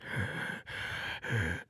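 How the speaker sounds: a soft, breathless mock gasp down the phone; imitated gasp. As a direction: gasp like you're too freaked to speak. Friend gasps on the phone.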